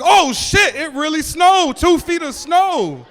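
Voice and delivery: high, loud shocked shout. Retold or imitated. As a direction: yell it as if you just saw the snow. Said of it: "I was like".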